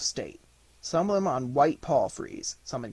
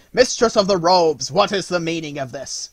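loud, furious royal demand; an enraged king demanding answers from a courtier; terrible angry cry; 4 semitones higher, 9 dB louder than usual. Acted. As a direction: roar at the mistress of the robes. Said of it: "cried in a terrible voice".

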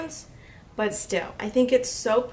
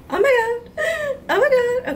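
excited squeal; 10 semitones higher, 4 dB louder than usual. Real, not acted. Unboxing a palette she loves.

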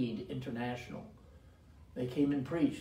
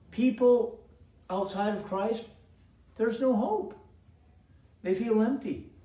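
loud, high fiery sermon; preaching; 8 semitones higher, 6 dB louder than usual. Acted. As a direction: cry it out to the congregation. Preaching at full force.

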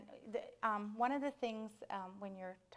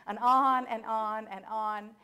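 slow, loud, stretched-out "on"s; drawn-out repetition; 2 semitones higher, 13 dB louder, slower than usual. Acted. Storyteller mocking the mouse's endless tale, long loud drawl. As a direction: lean long on every "on".

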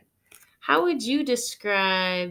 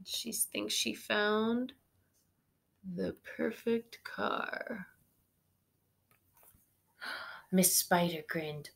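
ordinary delivery, nothing marked

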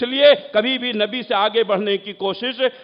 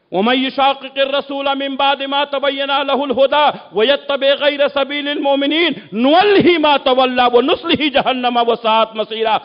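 rapid, forceful Arabic recitation; Quran recitation; 3 semitones higher, faster than usual. Real, not acted. Preacher citing God's verse on opposing the Messenger, rapid recitation. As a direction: recite the verse in a fast, driving run.

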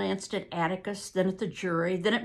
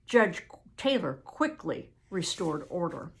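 plain reading voice

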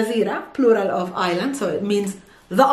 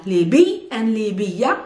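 normal talking voice

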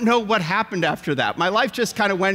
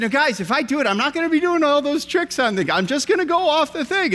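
hedging dad; 7 semitones higher, faster than usual. Retold or imitated. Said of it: "I said".

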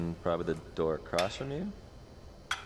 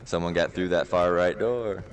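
raised voice, urgent enemy callouts; loud callout; 4 dB louder than usual. Real, not acted. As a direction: call positions loudly to your team. Calling enemy positions in combat.

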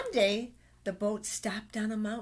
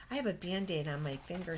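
plain reading voice

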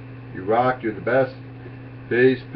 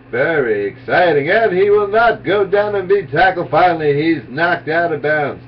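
Howard Cosell impression; 3 semitones higher than usual. Comic doing Howard Cosell calling a Michael Vick run, excited. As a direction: ham up a breathless Howard Cosell broadcast. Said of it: "straight Howard Cosell impersonation".

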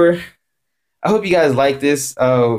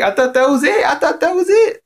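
very high, rising, stunned repetition; can't believe it, voice climbing; stunned disbelief; 13 semitones higher than usual. Retold or imitated. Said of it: "I was like".

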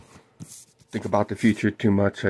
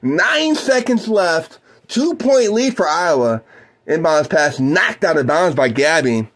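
play-by-play; 5 semitones higher, slower than usual. Acted. Calls the game for listeners.

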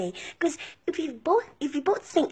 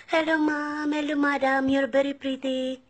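imitated Filipino girl. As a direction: put on a Filipino salesgirl's sing-song politeness. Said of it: "if those Filipino girl says".

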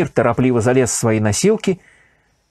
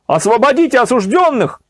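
crowd shouting; 10 semitones higher, 3 dB louder than usual. A narrator's reading. Crowd demanding release of the condemned, shouting. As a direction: demand it from the guards. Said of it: "Всё громче слышались возгласы".

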